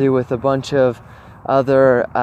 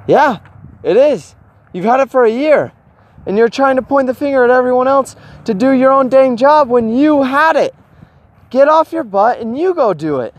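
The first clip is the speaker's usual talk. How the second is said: mock scolding; 9 semitones higher than usual. Inner voice scolding himself.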